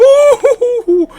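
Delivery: high, slow theatrical ho-ho laugh; hammy high laugh. Pretend. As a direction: cackle like a stage villainess. Mocking laugh.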